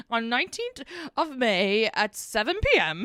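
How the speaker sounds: slow, precise listing of the date; pedantic exact date. Retold or imitated. To the forgetful guests, slow mock pedantic recital. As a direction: spell out the date like a stickler. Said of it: "And I'm like".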